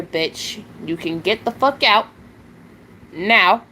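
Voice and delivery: real fury at a chat troll; angry telling off. Real, not acted. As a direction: throw the troll out with venom. Cursing out a troll on stream.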